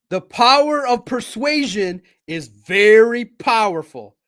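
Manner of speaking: power, persuasion, powerful with hard breathy P, slow and loud; slow, loud, each P in power persuasion powerful exploded; popped P sounds. Shown as a demonstration. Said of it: "See how those emphasize with the P?".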